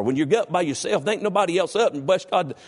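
ordinary delivery

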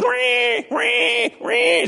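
high-pitched imitated baby wail; baby cry. Preacher imitating baby Moses in the basket, high wailing cry. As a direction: squeal out a newborn's repeated mock cries.

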